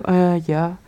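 ordinary talk

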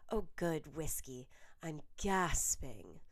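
slow, whispered, drawn-out posh mother; impression of her mother. A narrator's reading. Daphne mocks her mother to the girls, whispered and slow. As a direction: drag out the long A like her mother. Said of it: "dragged out the long A in a perfect impression of her mother".

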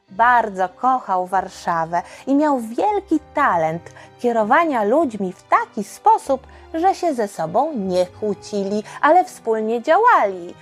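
ordinary delivery, nothing marked

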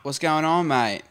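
hearty fake Aussie greeting; hammed-up Aussie accent; mock Australian. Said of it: "we were just talking Australian".

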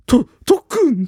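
high, loud stammered cry; startled shout. Retold or imitated. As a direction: blurt it out in shock. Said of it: "ってなった".

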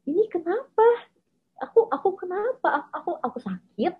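high-pitched bewildered self-questioning; distressed confusion. Retold or imitated. Recalling asking herself what is wrong, utterly confused. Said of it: "aku bener-bener bingung gitu loh".

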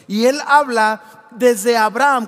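slow booming preacher emphasis; preaching shout. Real, not acted. Pastor booming the key point to the congregation. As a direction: proclaim it slowly and loud.

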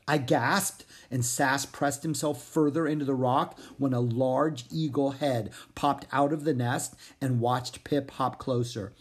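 plain reading voice

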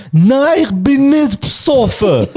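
high, loud, slow slurred protest; drunk insistence. Retold or imitated. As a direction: play the drunk girl denying it. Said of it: "eine kommt zu laufen".

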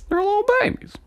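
put-on very high cooing baby voice; squeaky high baby-talk coo. Baby-talk coo about kids.

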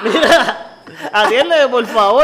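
high, loud, laughing plea; mock the old folks pleading through the door, laughing; begging old customer. Mocking old shoppers who poke in at the butcher, high laughing plea.

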